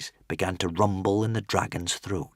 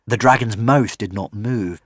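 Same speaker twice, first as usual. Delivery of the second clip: plain reading voice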